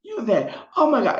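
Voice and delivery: high, shrieking imitation of brawling guests; high mock talk show fight; mock cussing guests. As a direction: squeal the insults like a catfight. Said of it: "people are cussing and fussing".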